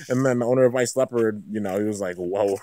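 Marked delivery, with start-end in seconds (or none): none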